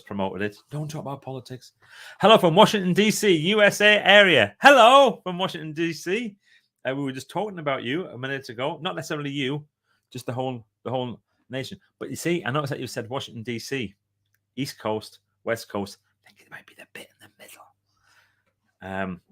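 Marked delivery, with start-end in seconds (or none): 2.2-4.5 excited greeting. Acted. Reading out a viewer greeting. 4.6-6.4 excited greeting. Acted. Repeating the greeting with gusto. 16.2-17.6 a fast whispered aside, almost unvoiced. Real, not acted. An aside to viewers about the middle of the US, whispered.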